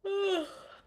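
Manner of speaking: high, loud exaggerated sigh; theatrical yawn-sigh; big stretching sigh. Shown as a demonstration. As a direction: let out a big contented sigh. Acts out deep breath.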